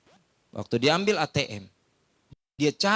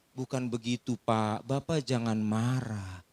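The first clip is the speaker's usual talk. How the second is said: soothing lady; 7 semitones lower, 5 dB quieter than usual. Retold or imitated. Lady calms the pastor.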